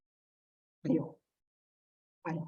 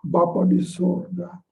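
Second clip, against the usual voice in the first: slow, lower put-on solemn prayer voice; mock-pious prayer; 4 semitones lower, 4 dB louder, slower than usual. Preacher mimicking people who pretend while praying, mock-pious. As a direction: intone it slowly with false reverence. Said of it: "Kamu yang pura-pura".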